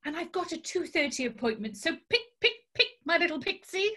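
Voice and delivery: fast, impatient rush; hurried. Acted. Tarot reader rushing her client to pick a card fast. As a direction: rattle it off to hurry her.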